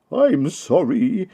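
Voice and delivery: put-on the book thief apologises to the children, high and slow; sniffly apology. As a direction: say sorry like a caught, tearful man. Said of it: "he sniffed".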